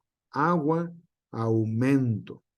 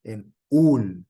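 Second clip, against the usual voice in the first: ul hit louder as the strong syllable; stress on UL; 4 semitones lower, 6 dB louder than usual. Shown as a demonstration. Teacher shows where Spanish puts the accent, ul stressed. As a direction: lean hard on ul. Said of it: "Porque el acento".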